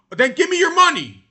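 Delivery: shouted, high and loud; loud retort. Real, not acted. Host yelling back at a player who says money isn't everything, loud. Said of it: "If it's not about the money".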